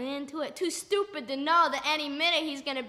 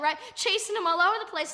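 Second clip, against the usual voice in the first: ordinary delivery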